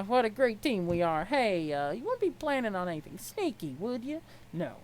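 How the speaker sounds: usual speaking voice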